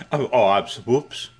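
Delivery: normal talking voice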